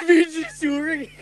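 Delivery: high, loud mocking laugh; laughing disbelief. Real, not acted. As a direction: scoff it out through a laugh.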